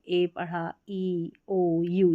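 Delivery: ए, ई, ओ, यू as plain letter names; alphabet sound. Shown as a demonstration. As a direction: list ए, ई, ओ, यू with long vowels. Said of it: "alphabet sound".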